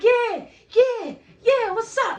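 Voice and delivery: high loud cocky challenge; hyped yell. Retold or imitated. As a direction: bark it like you're ready. Hyped challenge during commotion.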